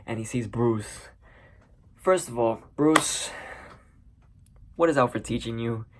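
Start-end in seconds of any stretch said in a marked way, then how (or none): none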